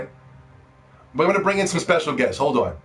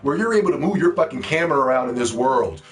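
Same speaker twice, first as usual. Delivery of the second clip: ordinary delivery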